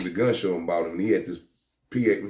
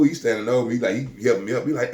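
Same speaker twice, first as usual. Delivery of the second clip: usual speaking voice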